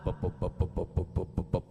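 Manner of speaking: low, soft bep bep chatter sounds; mock babble noise. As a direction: mimic someone who never stops talking. Mimics endless chatter as noise. (tiruan suara ocehan rendah)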